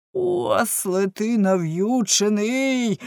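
slow, drawn-out exasperated insult; exasperated yell. A narrator's reading. Baker berates his servant, a drawn-out exasperated yell. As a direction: drag out the insult, yelling with restraint. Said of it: "зарепетував пекар".

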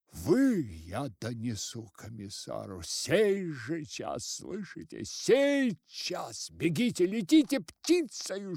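loud, high commanding shouts; angry threat. A narrator's reading. Village head threatening his constables, loud angry orders. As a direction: drive them off with orders.

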